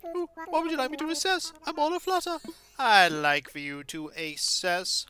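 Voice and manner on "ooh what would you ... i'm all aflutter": high, fluttery curator; all aflutter and eager, pitched high; excited owl. Acted. Owl curator eager to assess fossils, high and fluttery.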